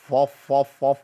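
فف said thick and heavy; heavy f drilled. Shown as a demonstration. Teacher isolates the wrong thick f. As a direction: repeat فف with a dark f. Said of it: "ما ينفعش".

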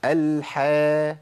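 very slow الحا with a thin front vowel; drawn-out, unthickened الحا; correct light ح. Shown as a demonstration. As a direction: hold الحا light, towards "hae". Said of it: "لكن عندنا في القرآن".

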